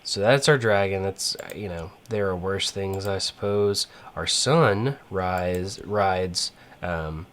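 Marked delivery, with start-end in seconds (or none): none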